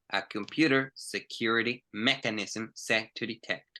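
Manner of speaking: slow, word-by-word model reading; slow scanning read. Shown as a demonstration. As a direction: read it slowly, scanning ahead. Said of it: "buscar esas tres letras".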